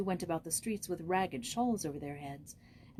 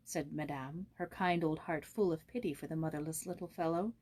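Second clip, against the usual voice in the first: ordinary delivery, nothing marked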